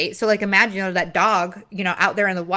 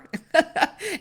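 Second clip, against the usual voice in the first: self-mocking laugh; 8 semitones higher than usual. Real, not acted. Laughs at her own denial.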